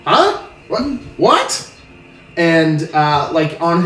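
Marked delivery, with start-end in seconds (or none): 0.0-1.7 high, jumpy "Huh? What?" as if caught off guard; be snapped out of shouting orders, baffled; a flustered, high double-take; startled confusion. Acted. Dock master startled by the players.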